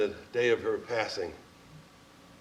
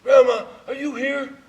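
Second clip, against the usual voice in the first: calling out; 12 semitones higher, 10 dB louder than usual. Retold or imitated. Calling softly to dying grandmother.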